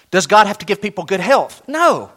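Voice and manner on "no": ordinary talk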